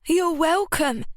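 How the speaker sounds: high, slow, smug martyr; superior martyrdom. A narrator's reading. Ruby accepting thanks with superior martyrdom. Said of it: "a look of superior martyrdom".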